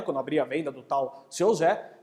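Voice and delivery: ordinary talk, nothing marked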